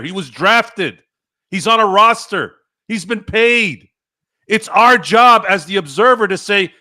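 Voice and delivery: loud, high-pitched tirade; angry rant. Real, not acted. Sports host tearing into excuses about credibility, angry. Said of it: "now you know where the anger comes from".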